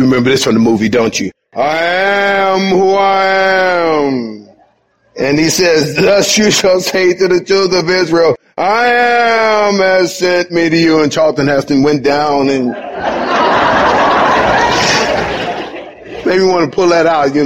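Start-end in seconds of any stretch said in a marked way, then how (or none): 1.5-4.5 slow, booming God voice from the film; movie God voice. Retold or imitated. Preacher quoting the movie, slow grand God voice. 5.9-11.0 movie proclamation. Retold or imitated. Preacher quoting Moses to Israel, shouted proclamation. 12.7-15.8 breathy unvoiced laughter; real laugh. Real, not acted. Preacher laughing at his Charlton Heston quote.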